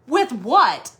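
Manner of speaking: exasperated retold question; indignant retort. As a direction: throw it back in disbelief. Retold indignant reply to daughter.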